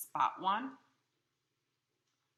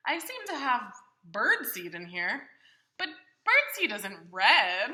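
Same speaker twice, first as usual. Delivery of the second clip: Big Bird voice; 13 dB louder than usual. Acted. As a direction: play a big friendly bird talking to the child. Reader voicing Big Bird's lines.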